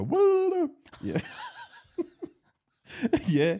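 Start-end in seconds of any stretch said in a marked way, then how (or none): 0.0-0.7 squeaky, laughing high whoa; a little figure's surprised high-pitched whoa; mock whoa. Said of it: "come out from in between her tits and go".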